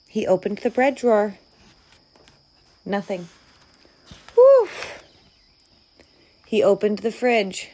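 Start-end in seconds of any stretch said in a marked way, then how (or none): 4.3-4.7 high, loud whew of relief; exaggerated phew of relief; relieved whew. A narrator's reading. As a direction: puff out a big whew. Relief at finding no mouse.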